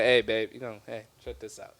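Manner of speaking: smooth talk. Retold or imitated. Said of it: "I was like".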